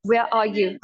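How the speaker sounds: British accent, R dropped from where and are. Shown as a demonstration. Teacher contrasts for learners, British accent. British take after American ones.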